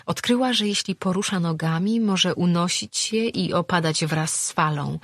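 ordinary delivery, nothing marked

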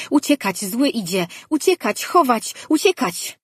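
very high, loud squeals that climb with each "uciekać"; a terrified pet shrieking to run and hide; panicked little creature. A narrator's reading. The pet runs round its cage warning that the evil one comes, shrieking in panic.

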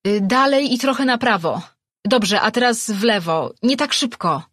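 loud, high call guiding the light. Acted. Rejwan directs the servant from the crevice, calling loudly. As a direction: shout the direction through the gap.

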